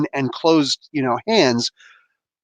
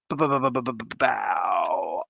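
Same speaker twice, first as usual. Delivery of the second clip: mock stutter; 5 semitones lower, 7 dB quieter than usual. Stuttering sound effect gag.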